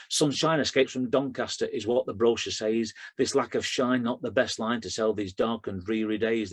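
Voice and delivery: rhythmic, driving performance poetry; poem recitation. Acted. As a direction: recite it to a steady beat, hitting the rhymes. Performs his own poem.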